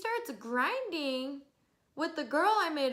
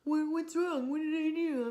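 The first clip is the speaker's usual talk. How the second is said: mock clueless guy; 5 semitones higher than usual. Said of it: "He's like".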